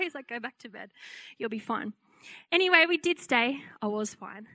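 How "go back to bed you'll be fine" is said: soft, quiet, unbothered reassurance; sleepy reassurance. Retold or imitated. Husband soothing his panicking wife at midnight, soft and quiet. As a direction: murmur it like a half-asleep husband. Husband calming her again.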